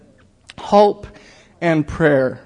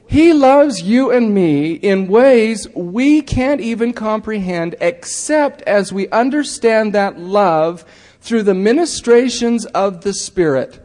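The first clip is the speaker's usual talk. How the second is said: fervent preaching; 5 semitones higher, slower than usual. Real, not acted. Preacher telling the congregation his whole message, fervent. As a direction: proclaim it with full conviction.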